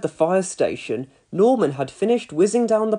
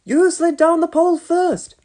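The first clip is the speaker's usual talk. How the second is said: put-on cheeky boy; 12 semitones higher, 5 dB louder than usual. A narrator's reading. Boy answers back.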